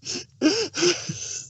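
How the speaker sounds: real high burst of laughter, then apologizing; breaking into laughter. Real, not acted. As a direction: crack up mid-scene.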